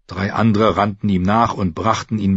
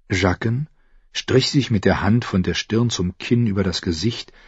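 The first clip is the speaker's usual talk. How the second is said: usual speaking voice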